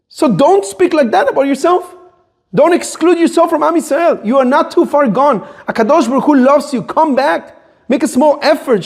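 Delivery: high-pitched, emphatic reassurance; impassioned exclamations, pitch held high; intense exhortation. Shown as a demonstration. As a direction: plead with him urgently to come back. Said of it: "with intensity".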